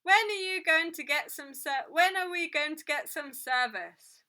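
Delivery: loud, high, exasperated call for service; impatient demand. A narrator's reading. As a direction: demand attention across a busy room. Impatient customer calling for service.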